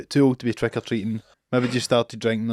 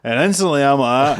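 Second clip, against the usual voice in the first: normal talking voice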